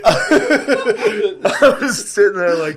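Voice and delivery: high, slow words squeezed through laughter; laughing hard. Real, not acted. Telling co-host about the credits, helpless high laughter. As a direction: barely get it out for laughing. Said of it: "I started scream laughing".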